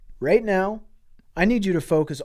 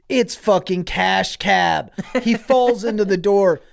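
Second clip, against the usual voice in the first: ordinary talk, nothing marked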